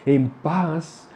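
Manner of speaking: soothing consolation. Acted. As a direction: lull a grieving mother gently and slowly. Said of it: "le habla de una manera hermosa".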